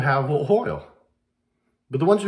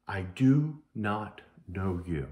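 solemn verdict; 7 semitones lower, 6 dB quieter, slower than usual. Acted. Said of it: "will say to people on the day".

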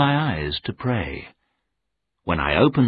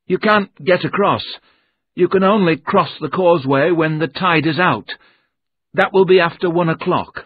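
higher voice of a jittery little man; nervous little clerk; 7 semitones higher, 4 dB louder than usual. Acted. Mister Jerome explaining the causeway to the narrator, nervous clerk voice. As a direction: play the anxious clerk, a bit hurried.

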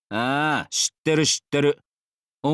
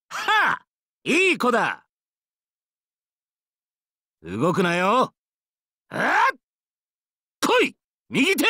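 battle cries; 8 semitones higher than usual. Acted. Combat cries.

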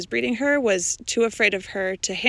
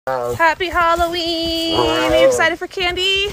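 excited pet talk; 9 semitones higher, 8 dB louder than usual. Real, not acted. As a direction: gush at the animal with delight. Excited greeting to animal.

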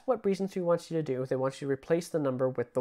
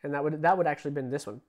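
normal talking voice

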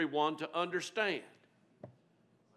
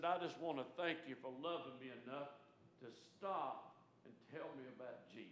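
hushed, much softer, choked-up thanks; a grateful boy's soft, tearful voice; 13 dB quieter than usual. Retold or imitated. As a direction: say it barely above a breath, moved to tears. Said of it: "He's crying by now".

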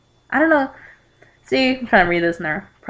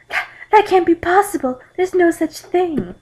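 acted shock, pitched way up; gasped disbelief; 10 semitones higher than usual. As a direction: gasp it out in high, stunned disbelief. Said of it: "I gasped".

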